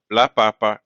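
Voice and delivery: "papa" stressed on the first pa; stress on first syllable. Shown as a demonstration. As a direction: recap "la papa" as PA-pa. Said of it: "a note on pronunciation".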